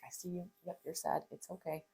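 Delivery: quiet, tender reassurance; soothing mom. Retold or imitated. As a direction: comfort a crying child softly.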